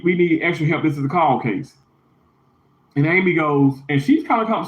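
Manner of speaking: usual speaking voice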